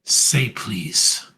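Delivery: a low, cold "Say please" from the boss; smug demand. Retold or imitated. Boss to Heyman, a low smug taunt. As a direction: make him beg with a low taunt. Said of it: "And he looks and he goes".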